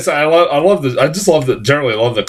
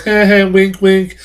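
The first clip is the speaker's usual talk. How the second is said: cheesy wink; 8 semitones higher, 3 dB louder than usual. Retold or imitated.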